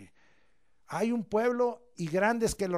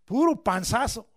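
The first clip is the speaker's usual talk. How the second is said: a hint of a teacher reading out a grade; mock teacher grading; 9 dB louder than usual. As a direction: call out the barely-passing grade. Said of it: "no habrá uno aquí que tenga un A".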